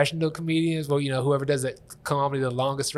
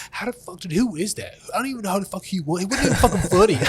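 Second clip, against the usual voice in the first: higher, whiny put-on voice for the haters; mocking commenters; 6 semitones higher, 4 dB louder than usual. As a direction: sneer like an online hater. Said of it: "reading people going".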